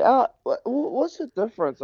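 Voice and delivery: high mock bewildered voice; mimicking a confused Aussie. Golfer mimicking an Aussie asking about rugby, pitched high. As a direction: squeak it out like a clueless bloke. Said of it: "someone said to me yesterday".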